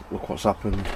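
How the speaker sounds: normal talking voice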